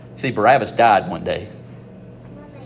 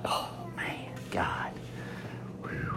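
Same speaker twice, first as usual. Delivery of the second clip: breathless whispered wonder at the irony; awed whisper; 4 semitones lower, 18 dB quieter, slower than usual. Real, not acted. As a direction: hush it, almost to yourself, amazed.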